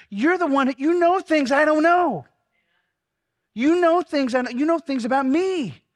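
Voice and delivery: high, loud prayer crying out to Jesus; impassioned prayer. Acted. Pastor praying over his congregation, high and loud. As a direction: pour it out like a fervent prayer, building. Prays aloud at full force.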